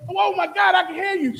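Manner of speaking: high, loud oh-my-God; shocked exclaim. Retold or imitated. Psychic realizing she truly hears the ghost, high shocked cry. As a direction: shaken disbelief pouring out. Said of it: "And she said".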